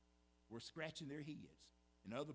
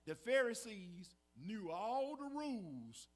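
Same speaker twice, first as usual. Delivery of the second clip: all hit loud and slow; stress on all; 4 semitones higher, 6 dB louder, slower than usual. Real, not acted. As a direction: lean hard on the word all.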